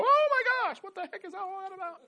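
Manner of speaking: high, shocked exclaim breaking into a laugh; bewildered reader. Retold or imitated. Pastor imitating his younger self, high and bewildered. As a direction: play your old confused self. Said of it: "I used to read Revelations and go".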